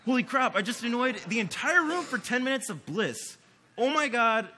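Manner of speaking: high, loud retold outburst; excited exclaiming. Collaborator reporting back on the toy, excited exclaiming. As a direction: blurt it out like a thrilled buddy. Acts out collaborator's thrilled reaction.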